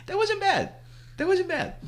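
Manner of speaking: very high, squeaky self-praise; amused squeak. Real, not acted. Host pleased with homemade sound effect, high squeaky voice. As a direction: pitch it way up, amused.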